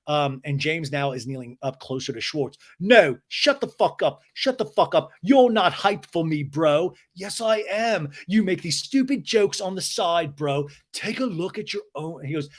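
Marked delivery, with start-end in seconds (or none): 2.8-12.2 high, heated mock argument; James's angry rant. Recapper imitating James telling off Schwartz, high and heated. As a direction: imitate an indignant man cutting his friend off.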